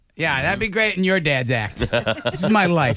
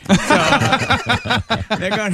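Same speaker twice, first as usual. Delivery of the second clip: ordinary talk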